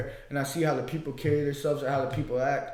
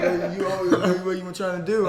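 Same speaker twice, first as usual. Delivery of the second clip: mock tough guy, laughing; 4 semitones higher, 4 dB louder than usual. Stranger's hostile reply, retold laughing.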